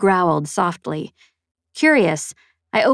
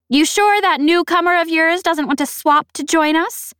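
high, loud, boastful snappish alien; 11 semitones higher, 4 dB louder than usual. A narrator's reading. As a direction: brag about your champion, snapping. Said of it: "snappish and vaguely familiar".

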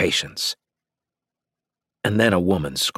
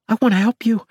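very high, louder woman's voice; pleading woman; 6 dB louder, faster than usual. A narrator's reading. Freed prisoner offering to help her rescuer, pleading.

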